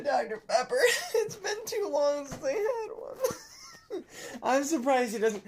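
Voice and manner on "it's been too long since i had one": slow, high, laughing through it. Real, not acted. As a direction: still giggling helplessly. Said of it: "I'm crying".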